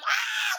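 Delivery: very high fangirl scream; re-enacted shriek at a celebrity; starstruck scream. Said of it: "I was screaming in her face".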